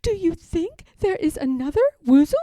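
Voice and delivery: high, timid little Piglet voice; a small nervous piglet asking. A narrator's reading. Piglet asks Pooh about the tracks, high and timid.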